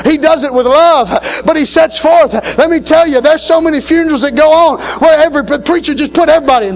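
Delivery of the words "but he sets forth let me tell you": usual speaking voice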